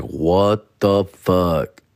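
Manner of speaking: very slow, stretched shock; drawn-out disbelief. Retold or imitated. Comedian reacting to the Jiffy Lube news, slow stunned disbelief. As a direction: let it hang in slow shock. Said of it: "Yeah, I'm like".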